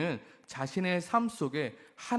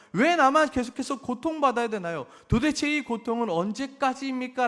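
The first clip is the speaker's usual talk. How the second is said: louder, pleading why me; anguished lament; 6 semitones higher, 4 dB louder than usual. Acted. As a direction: cry out your pain to God. Voicing the people's lament to God. (크게 애원하며 탄식하듯)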